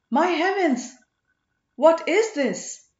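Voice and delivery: high, loud cry, then slow astonished question; astonished exclamation. A narrator's reading. Ponnan seeing soldiers and a palanquin, loudly astonished. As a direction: gasp it out in alarm. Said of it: "Ponnan exclaimed".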